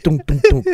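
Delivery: three spaced knocking sounds; imitated door knock. TV uncle knocks on the door, imitated knocking. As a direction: mimic knuckles on a door, knock by knock. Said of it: "They knock on the door like".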